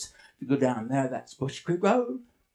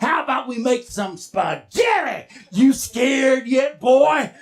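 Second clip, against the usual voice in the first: slow, loud, high monster taunt; taunting bushman; 5 semitones higher, 5 dB louder than usual. Acted. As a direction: drag it out to scare the boy.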